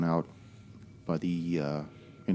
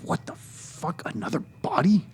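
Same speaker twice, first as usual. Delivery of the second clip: harsh unvoiced shout, high and slow; whisper yelling; 9 semitones higher, 3 dB louder than usual. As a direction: yell it in a hoarse whisper. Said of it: "You hear him do the whisper yell".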